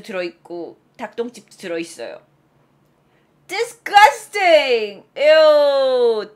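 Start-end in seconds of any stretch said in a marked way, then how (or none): 3.5-6.3 loud, high, long drawn-out revulsion; big exaggerated "ew"; disgusted. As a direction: cry out, grossed out by a mess. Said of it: "이라고 하죠".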